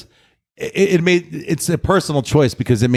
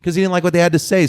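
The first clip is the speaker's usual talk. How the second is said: ordinary talk, nothing marked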